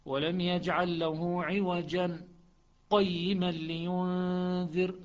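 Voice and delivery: slow recitation, clear pause after عوجا before قيما; Hafs with a clear pause. Shown as a demonstration. Teacher demonstrating Hafs's pause to students. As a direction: stop briefly on عوجا with held breath, then قيما. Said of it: "سكتة واضحة".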